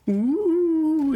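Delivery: very high, drawn-out mystical ooh; a high spooky wavering ooh. Acted. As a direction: coo it up high like magic. Goblin mind-reading bit.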